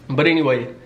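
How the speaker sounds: ordinary talk, nothing marked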